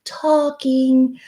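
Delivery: a coach showing a sweet talking tone; nice and kind sound. Shown as a demonstration. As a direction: speak it sweetly, like a kind friend. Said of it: "call it the nice and kind sound".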